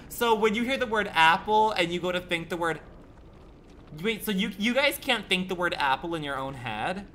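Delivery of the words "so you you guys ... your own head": ordinary talk, nothing marked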